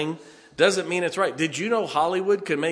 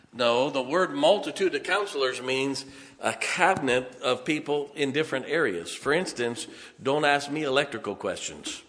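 ordinary talk

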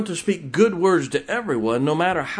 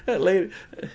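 ordinary delivery

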